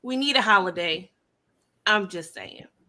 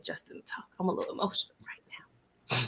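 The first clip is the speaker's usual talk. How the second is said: whisper; 4 semitones lower, 11 dB quieter than usual. Pretend. Emotional mock award speech.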